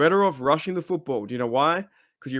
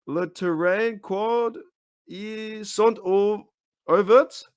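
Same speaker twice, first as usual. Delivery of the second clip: slow, higher-pitched, stumbling French; French accent attempt; 6 semitones higher, much slower than usual. Shown as a demonstration. Host finishes reading the French post, slow attempted French accent. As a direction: sound out the French haltingly, pitched up. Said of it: "gonna try my best French accent".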